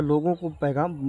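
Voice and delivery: normal talking voice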